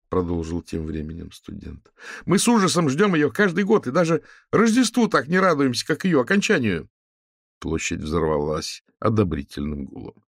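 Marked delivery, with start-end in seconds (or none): 2.2-6.9 high, loud ringing student voice. A narrator's reading. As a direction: ring out across the square. Student continues his complaint.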